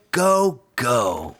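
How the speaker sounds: usual speaking voice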